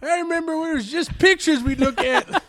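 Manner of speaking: put-on high old-timer reminiscing; creaky old geezer voice; old prospector.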